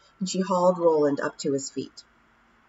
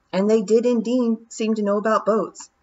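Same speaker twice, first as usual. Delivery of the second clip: usual speaking voice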